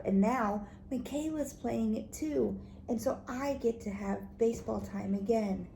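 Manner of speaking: ordinary delivery, nothing marked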